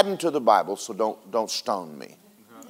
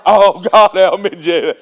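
louder, hammed-up cry for help; mock panicked plea; 6 dB louder than usual. Preacher imitating a broke believer praying, mock panic. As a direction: beg God in mock panic. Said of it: "When the checkbook's down".

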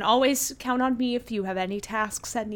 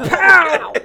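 stamp sound; 8 semitones higher, 12 dB louder than usual. Shown as a demonstration. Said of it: "It's the sound of the stamp".

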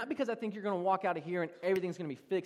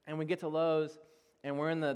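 ordinary talk, nothing marked

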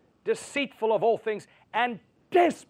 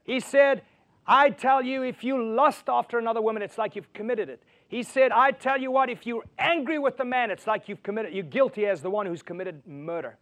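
raised, building preacher's voice; preaching; 3 semitones higher, 4 dB louder than usual. Acted. Preacher driving the point home to his church, fervent. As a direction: preach it out to the congregation. Preaching sermon at full force.

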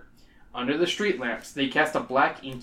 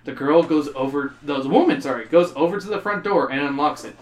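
usual speaking voice

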